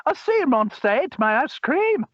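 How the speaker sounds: squeaky cartoon kid line; cartoon character quote. Another speaker repeats quote.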